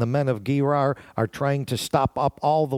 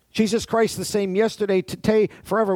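preaching build; 6 semitones higher than usual. Acted. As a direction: lift the crowd on forever. Preaching climax, rising.

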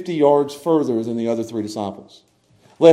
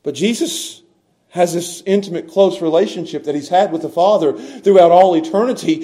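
usual speaking voice

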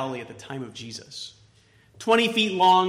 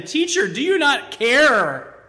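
panicked plea; 9 semitones higher, 7 dB louder than usual. Acted. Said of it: "said to him".

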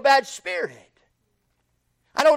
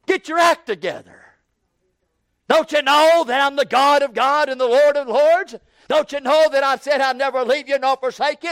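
louder, scolding pretend rebuke; mock rebuke; 4 semitones higher, 4 dB louder than usual. Preacher acting a scolding God to a downcast believer, mock angry. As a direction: scold her the way he never would. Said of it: "He don't talk to her that way".

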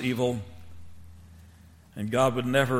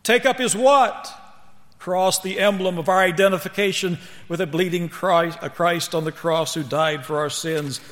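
high, raised preaching voice, easing toward the end; 5 semitones higher, 5 dB louder than usual. Real, not acted. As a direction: press the call on the congregation. Preaches at full force.

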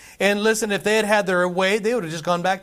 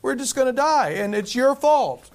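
whiny complaint; 7 semitones higher than usual. Retold or imitated. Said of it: "we're like".

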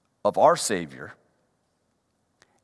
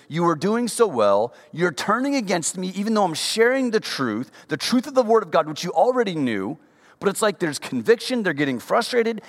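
high, pressing sermon voice; fervent preaching; 7 semitones higher than usual. Acted. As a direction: press the point home to the congregation. Preacher paraphrasing Paul with force.

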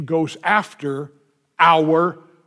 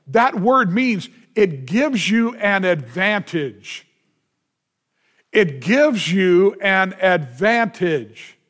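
slow emphasis; slower than usual. Acted. Explaining the word, slowing.

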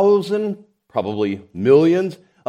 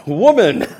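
laughing delight; 6 semitones higher, 7 dB louder than usual. Acted. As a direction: chuckle out the word with glee.

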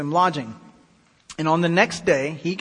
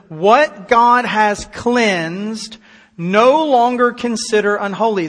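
"What God has cleansed" very slow and loud, each word drawn out; solemn proclamation; 3 semitones higher, 5 dB louder, much slower than usual. Acted. Preacher voices heaven's command to Peter, "cleansed" proclaimed slowly and forcefully.

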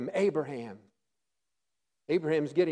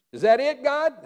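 higher and louder, incredulous; surprised question; 7 semitones higher, 6 dB louder than usual. Acted. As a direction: sound let down and surprised. Surprised Moses voiced.

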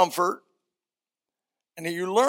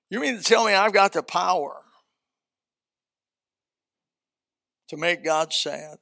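incredulous question. Acted. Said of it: "Think about what I just said".

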